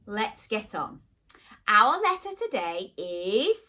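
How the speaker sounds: ordinary delivery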